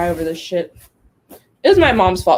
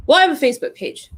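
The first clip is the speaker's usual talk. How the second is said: higher, faster smug client voice; mock client; 6 semitones higher, faster than usual. Mocking a client who thinks Facebook is marketing, smug and quick. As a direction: rattle it off like a proud know-it-all. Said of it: "What do you mean?".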